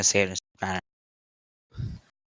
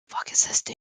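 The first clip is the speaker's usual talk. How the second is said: whispered; 6 dB quieter than usual. Retold or imitated. Retold inner confused thought.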